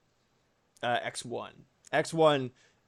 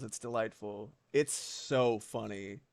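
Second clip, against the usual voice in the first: normal talking voice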